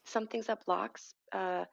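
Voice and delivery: ordinary talk, nothing marked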